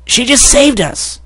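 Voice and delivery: high, slow little filly voice. Acted. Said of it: "Skulu added".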